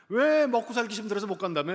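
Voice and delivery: loud, high mocking jab; playful sarcasm. Preacher ribbing the man about his old excuse, playfully sarcastic. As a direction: tease with an exaggerated taunt. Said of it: "장난치느라고 비아냥댔거든요".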